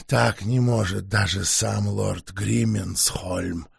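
low, slow, quiet vampire; exhausted vampire forcing out words; weary vampire voice. Acted. Vampire explains slowly.

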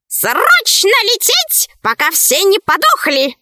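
high bird voice, slow drawn-out start; urgent talking bird. A narrator's reading. Left head confirms the emergency, high bird voice. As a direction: echo the alarm as a high bird. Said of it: "подтвердила левая".

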